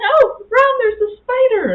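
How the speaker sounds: high, loud mock-frightened cry; scared squeal. Telling husband about the spider, high frightened squeal. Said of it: "And I'm like".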